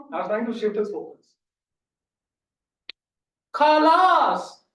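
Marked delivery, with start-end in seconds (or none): 3.5-4.6 shout. Retold or imitated. Friend declares he will run.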